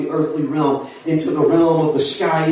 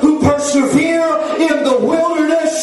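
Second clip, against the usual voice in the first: high, drawn-out revival scripture cry; full-force preaching; 8 semitones higher than usual. Real, not acted. Preacher rousing the congregation with Isaiah, soaring preaching. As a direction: build it into a soaring call to praise.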